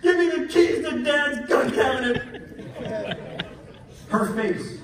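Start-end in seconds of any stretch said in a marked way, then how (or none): 0.0-3.5 high, slow, hammed-up wailing; crying outburst. Son crying to mom for the cabinet keys, mock wail. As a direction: wail it like a sobbing, desperate son. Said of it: "I'm crying my eyes out, and I say".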